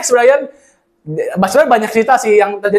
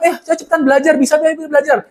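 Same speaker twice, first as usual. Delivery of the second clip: pushy urging; 6 semitones higher than usual. Retold or imitated. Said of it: "dia bilang".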